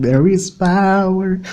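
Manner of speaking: a hint of preacher, drawn out; mild mock preacher. Speaker repeats the preacher line half-mocking. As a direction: lean on it like a sermon, lightly. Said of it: "they might copyright me".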